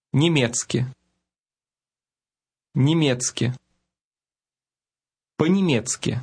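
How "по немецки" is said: ordinary talk, nothing marked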